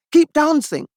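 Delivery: self-encouragement. Retold or imitated. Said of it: "I told myself".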